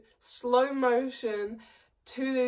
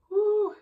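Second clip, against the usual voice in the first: high whoo sound; 11 semitones higher, 5 dB louder than usual. Retold or imitated. Imitated exclamation.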